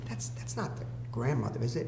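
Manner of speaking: hushed question. Retold or imitated.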